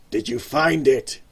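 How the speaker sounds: a gang boss growls at his man; angry growl. Acted. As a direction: growl it like a threat. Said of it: "growled an angry voice".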